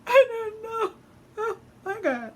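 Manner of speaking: very high, drawn-out wail; mock crying. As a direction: whimper it like a tearful daughter to her mom. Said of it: "I'm crying too".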